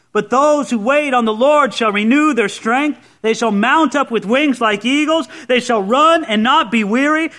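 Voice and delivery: high, driving preacher's proclamation; impassioned preaching. Acted. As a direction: preach it to the whole hall with ringing emphasis. Preaches at full force.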